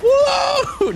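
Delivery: very high startled whoa. Real, not acted. Streamer seeing everyone fly off, startled whoa. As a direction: yelp in surprise.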